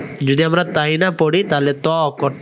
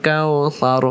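slow recitation; much slower than usual. Shown as a demonstration. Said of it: "এখান থেকে".

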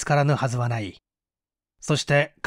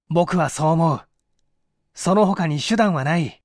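ordinary delivery, nothing marked

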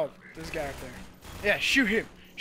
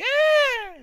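high, loud hammed-up yelp; mock scream; 13 dB louder than usual. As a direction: yelp like a cartoon hero falling. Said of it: "I'm like".

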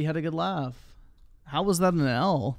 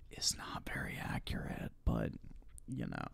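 whispered aside, much softer; 13 dB quieter than usual. Real, not acted. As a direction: drop to a whisper for the joke. Aside muttered under breath.

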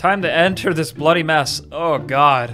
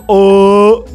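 mock falling cry; 8 dB louder than usual. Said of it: "He was".

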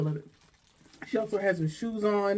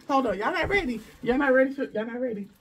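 hyped teasing; 6 semitones higher, 4 dB louder than usual. Real, not acted. Teasing big reveal.

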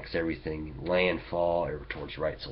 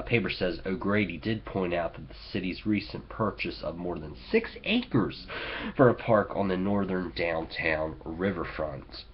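usual speaking voice

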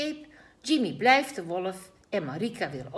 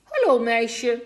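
slow, loud wolf greeting; a lightly voiced wolf luring the girl; sly wolf; 6 semitones higher, 11 dB louder, slower than usual. A narrator's reading.

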